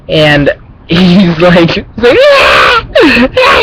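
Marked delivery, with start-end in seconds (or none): 2.2-3.6 high-pitched wailing mock scream; mimicked scream. Imitating a bully hit by thrown ice, high mock screaming. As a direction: scream like someone hit in the teeth. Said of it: "He's, like, screaming".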